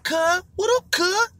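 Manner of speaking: squeaky mock Crip greeting; mock high gangster greeting. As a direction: mimic him poking his head in the door. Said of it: "and he'd be like".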